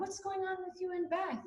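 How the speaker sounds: mock gentle question; lightly imitating a tender mother. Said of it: "she thinks that that's how it's coming across".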